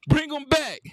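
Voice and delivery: loud taunting repeat. Real, not acted. Gamer taunting his opponent after a bucket, loud and high. Trash talk after a score.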